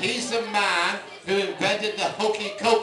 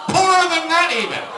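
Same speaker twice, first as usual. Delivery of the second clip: much higher, much louder comic outburst; building to a shout; 12 semitones higher, 11 dB louder than usual. Acted. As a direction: top the last line with a big yell. Escalating joke about being poor.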